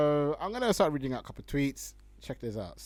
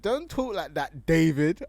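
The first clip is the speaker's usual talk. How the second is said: lightly voiced quoted reply, a touch raised; quoting the reply; 4 semitones higher, 8 dB louder than usual. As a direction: read the reply out with mild mock weight. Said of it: "And then the guy responded".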